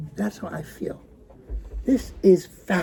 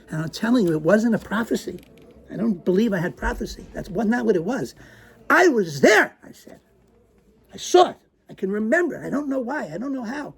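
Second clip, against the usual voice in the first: rising, wild-eyed insistence; crazed; 4 semitones higher than usual. Acted. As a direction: sound a bit unhinged with excitement. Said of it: "a crazed attitude in my voice".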